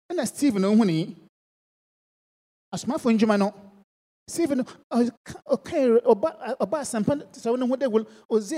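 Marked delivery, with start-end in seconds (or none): none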